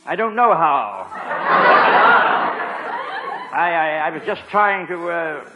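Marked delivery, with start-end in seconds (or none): 1.1-3.5 high, jittery nervous giggle; nervous laugh. Acted. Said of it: "I must be nervous".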